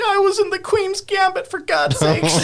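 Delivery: high, hammed-up wail of despair; mock sobbing. Actress playing Peach breaks down at Mario's line, mock sobbing. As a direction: fake a tearful protest, starting very high. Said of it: "then she just starts sobbing".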